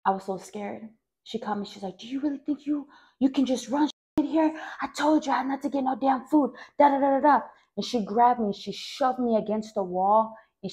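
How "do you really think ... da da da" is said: scolding mother. Retold or imitated. Mother scolds after catching her.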